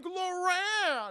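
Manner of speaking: loud, high calling of the name; husband calling out for Loran. Retold or imitated. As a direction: shout it through the walls. Ed calls for missing wife.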